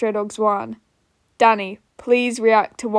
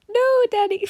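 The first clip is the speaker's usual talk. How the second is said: much higher squeaky character voice; Hootie voice; 12 semitones higher than usual. Shown as a demonstration. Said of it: "That's my first attempt at Hootie's voice".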